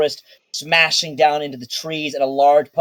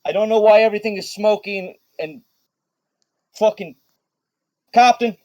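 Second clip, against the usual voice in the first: higher, louder, fast babble; flustered rant; 7 semitones higher, 3 dB louder, faster than usual. Acted. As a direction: spill out words in a frantic rush. Riz flustered after battle.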